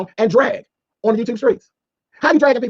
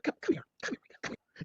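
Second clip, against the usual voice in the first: whispered beckon; 9 semitones higher, 13 dB quieter, slower than usual. Acted. Beckoning Rico closer to let him in on a secret, whispered. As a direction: whisper come here, come here.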